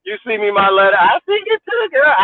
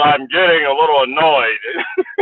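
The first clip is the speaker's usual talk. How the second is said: slow, lower mock of a prim speaker; imitating Jalvin Bowden; 6 semitones lower, slower than usual. Mimicking a commentator's prim complaint, slow. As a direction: drag it out like a stuffy official. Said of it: "He said".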